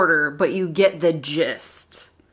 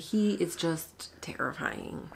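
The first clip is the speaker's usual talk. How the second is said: whisper; 12 dB quieter, slower than usual. Real, not acted. Whispered remark about Lalo.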